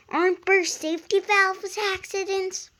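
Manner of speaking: high, quick teasing question; cheeky young engine. Acted. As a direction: needle him with a bright jab. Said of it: "Percy asked instantly".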